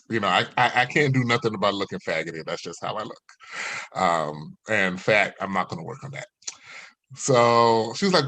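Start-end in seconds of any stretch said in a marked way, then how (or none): none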